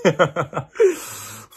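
loud, high-pitched laugh; burst of laughter. Real, not acted. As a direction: crack up at your own joke. Laughs at own creepy joke. (hard lachen)

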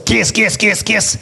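repeated with a light smoker's rasp; Péladeau's smoker's rasp. Retold or imitated. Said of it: "avec une vieille voix de fumeur". (voix rauque de vieux fumeur, à peine imitée)

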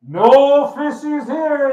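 high, drawn-out booming call; loud booming voice. Acted. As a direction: boom it out slowly from on high. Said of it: "there's this loud booming voice".

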